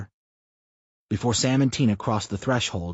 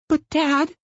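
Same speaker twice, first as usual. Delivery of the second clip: put-on high child's cry of protest; a little girl objecting; 6 dB louder than usual. A narrator's reading. Daughter objects to her father, high child protest.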